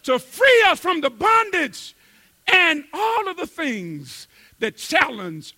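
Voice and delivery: high, loud, slow preaching climax; full-force preaching. Real, not acted. Preacher hammering the point home to the congregation. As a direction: drive the point home to the church.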